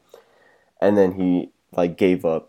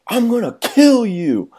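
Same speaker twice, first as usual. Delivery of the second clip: high, slow, louder menacing threat; drunk threat; 14 semitones higher, 5 dB louder, slower than usual. Retold or imitated. Drunk gunman threatening the hiding teens, mock menace. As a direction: drag it out like a crazed gunman. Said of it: "He's like".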